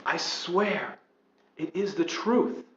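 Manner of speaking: usual speaking voice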